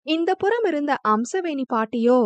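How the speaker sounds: ordinary delivery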